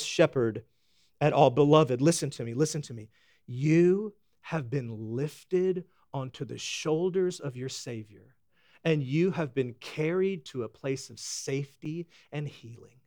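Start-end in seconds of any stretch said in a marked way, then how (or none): 1.5-13.0 quiet comforting preacher; tender pastoral voice. Acted. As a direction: lay it gently on their hearts.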